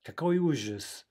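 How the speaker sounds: hammed-up horror; mock horror. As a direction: gasp it out like a tragedy. Mock dismay at bad answer.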